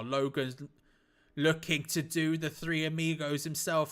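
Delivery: normal talking voice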